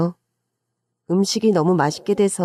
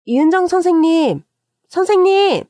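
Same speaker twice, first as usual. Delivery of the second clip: raised, carrying hail; urgent calling out; 7 semitones higher, 4 dB louder than usual. A narrator's reading. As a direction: call after a colleague to stop them. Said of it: "저는 급히 동서를 불러 세웠죠".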